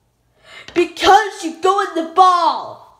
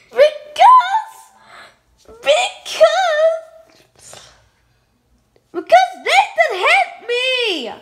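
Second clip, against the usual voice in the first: put-on high child voice; 10 semitones higher, 3 dB louder than usual. Child doll voice tattling.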